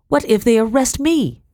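high, alarmed question. A narrator's reading. Wife afraid of being left to the rebels, alarmed. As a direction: challenge him with mounting worry. Wife fears arrest, challenges husband.

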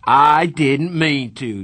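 slow, loud mocking echo; mocking shout. A narrator's reading. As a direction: throw his words back, shouting. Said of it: "Darry shouted".

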